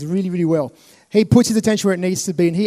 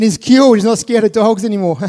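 excited thought; 3 semitones higher, 5 dB louder than usual. Retold or imitated. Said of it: "I'm thinking".

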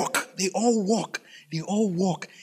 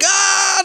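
high drawn-out "aah" yell; loud acted wail; exasperated cry; 11 semitones higher, 4 dB louder than usual. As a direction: break in with a high frustrated holler. Skit character breaks the routine.

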